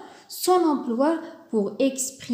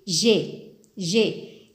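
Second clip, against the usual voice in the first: j'ai said slowly, then repeated low; slow careful j'ai; 4 semitones lower than usual. Shown as a demonstration. Teacher modelling j'ai for students, slow and clear. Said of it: "avec je, on dit".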